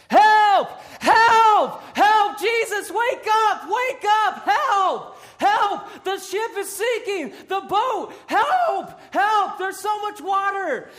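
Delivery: high, loud, terrified yelling; panicked cries for help. Acted. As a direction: scream for help as the boat sinks. Acting disciples panicking in storm.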